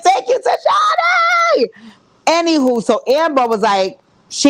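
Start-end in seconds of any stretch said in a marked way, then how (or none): 0.0-1.6 excited squeal. Real, not acted. Thanking a super chat donor.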